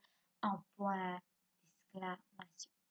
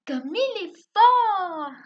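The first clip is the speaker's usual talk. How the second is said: very loud, high, stretched cry of admiration; explosive exclamation; 10 semitones higher, 15 dB louder, much slower than usual. Shown as a demonstration. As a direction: explode on it and hold the last word. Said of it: "un peu explosif".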